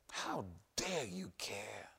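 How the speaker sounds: slow, low whispered taunt; whispering devil. Acted. Preacher voicing the devil's whisper to believers, slow. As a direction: breathe the devil's accusation. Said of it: "what the devil is saying".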